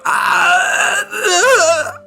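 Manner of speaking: high, drawn-out wail of pain; agonized cry. Acted. Game master acting a man whose arms are shrinking, agonized cry. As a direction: wail as the body painfully shrinks. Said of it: "armpits as he's like".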